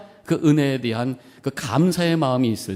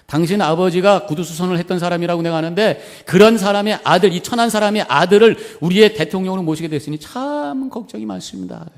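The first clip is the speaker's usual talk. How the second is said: loud, higher-pitched scornful jab; imitated contempt, pitched up and emphatic; mocking taunt; 7 semitones higher, 7 dB louder than usual. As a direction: taunt him like a sneering politician. Congressman taunting Lincoln in retold anecdote.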